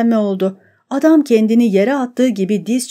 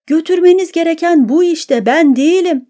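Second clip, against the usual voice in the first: higher, louder frantic cry; shout; 7 semitones higher, 3 dB louder than usual. A narrator's reading. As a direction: shout to shift the blame. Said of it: "diye bağırdı".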